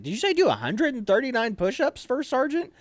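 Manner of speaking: high-pitched trainee query; incredulous question. Retold or imitated. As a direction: ask in acted-out disbelief. Trainee anxiously checks the order.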